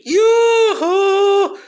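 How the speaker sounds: high, loud falsetto yoo-hoo; sing-song taunting call, way up high; shrill cartoonish yoo-hoo; mocking yoo-hoo.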